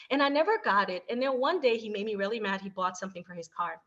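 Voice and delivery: normal talking voice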